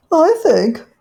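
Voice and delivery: high, loud genteel caller; a polite little dog at the door; Duchess's voice. Acted. Duchess calling at Rivy's porch, high and genteel.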